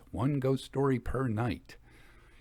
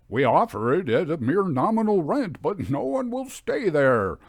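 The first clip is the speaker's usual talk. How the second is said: a lightly voiced clerk, higher and louder, complaining; 5 semitones higher, 6 dB louder than usual. Clerk telling the narrator about the haunted house, higher exasperated voice. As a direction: play the weary lawyer's clerk. Lawyer's clerk quoted in story.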